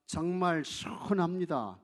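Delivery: slow, loud 시원합니다 with a long first syllable; drawn-out demonstration. Shown as a demonstration. Preacher leads the congregation in the standard phrase, drawn-out demonstration. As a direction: stretch the 시 of 시원합니다, leading a chorus. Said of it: "한번 같이 하겠습니다".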